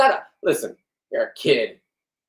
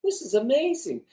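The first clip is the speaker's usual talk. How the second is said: awed wow; 12 semitones higher, 4 dB quieter than usual. Retold or imitated. Watching the fish jump on the boat, awed and soft. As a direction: marvel softly at the jumping fish. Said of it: "I'm sitting there going".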